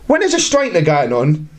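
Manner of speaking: normal talking voice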